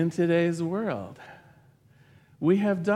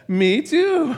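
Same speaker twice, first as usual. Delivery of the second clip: eager delight; 10 semitones higher, 7 dB louder than usual. Pretend. Joke teller matching every denomination.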